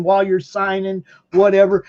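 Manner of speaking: normal talking voice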